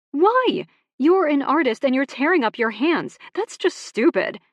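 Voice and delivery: high and loud, scolding; exasperated scolding. Acted. Sister scolding Ox.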